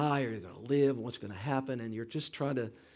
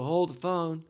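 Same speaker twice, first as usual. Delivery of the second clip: loud, raised-pitch call; retold shout; 5 semitones higher, 6 dB louder, slower than usual. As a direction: imitate dad calling out his catchphrase. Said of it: "He actually shouted it".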